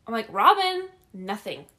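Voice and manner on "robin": high, loud re-enacted call; raised, higher call for someone who isn't answering; calling out louder. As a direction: shout the name to reach another room. Calls again after no answer.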